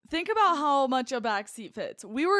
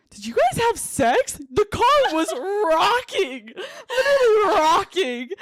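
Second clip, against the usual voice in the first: excited friend; 7 semitones higher, 6 dB louder than usual. Retold or imitated. Friend's quote, loud high.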